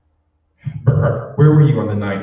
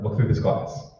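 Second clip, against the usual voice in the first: ordinary delivery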